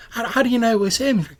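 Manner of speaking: high, loud challenge; doubting young woman. Acted. Second woman challenges her friend, high-pitched and loud. Two women argue over a sighting.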